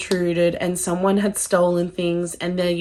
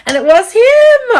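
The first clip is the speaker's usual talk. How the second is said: much higher and louder, squealing with delight; thrilled reveal; 11 dB louder than usual. Real, not acted. Telling viewers her Forrest guess came true, thrilled squeal. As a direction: burst out with it, vindicated.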